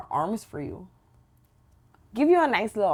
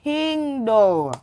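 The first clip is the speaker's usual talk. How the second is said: loud, drawn-out Toronto slang; loud slow slang rant; 6 semitones higher, 8 dB louder, slower than usual. Acted. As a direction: shout it slowly, mock offended. Hammed-up Toronto slang outburst.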